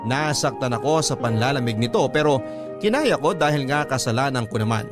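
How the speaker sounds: ordinary delivery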